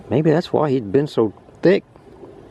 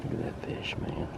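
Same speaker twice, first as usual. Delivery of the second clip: barely audible, very soft whisper; hushed whisper; 17 dB quieter than usual. Real, not acted. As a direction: whisper it under your breath. Hushed aside watching a fish.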